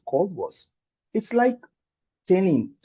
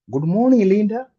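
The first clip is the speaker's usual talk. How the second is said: cheery greeting; 7 semitones higher, 6 dB louder than usual. Retold or imitated. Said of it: "Even the cleaning lady".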